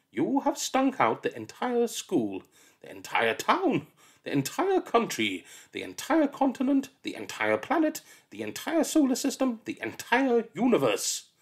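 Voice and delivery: building rant. Acted. Miss Seeth rants at the egg sandwich child, building. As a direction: pile it on, bigger each time.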